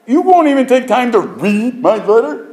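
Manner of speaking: high, loud, indignant whine; hurt letter writer. Pretend. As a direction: play the wounded writer, voice up high.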